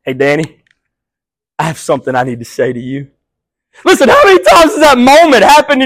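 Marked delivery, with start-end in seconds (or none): 0.0-3.1 softer, quick and kind, like Jesus leaning close; a softly spoken friend with a word for you; soft gentle Jesus voice. Retold or imitated. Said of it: "He goes".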